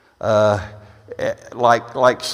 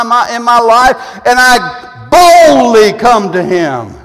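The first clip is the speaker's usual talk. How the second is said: impassioned preaching; 7 semitones higher, 9 dB louder than usual. Real, not acted. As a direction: build from firm to loud, high proclamation. Preaches at rising force.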